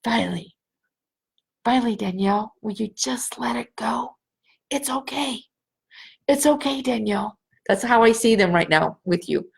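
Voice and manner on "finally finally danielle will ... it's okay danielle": soft coaxing whisper, fading in and out; whispering in her ear. Retold or imitated. Grandparents whisper reassurance into Danielle's ear, soft whisper. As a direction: lightly murmur it like a voice at her ear. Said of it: "they're whispering in your ear".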